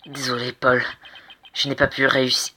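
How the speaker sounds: ordinary talk, nothing marked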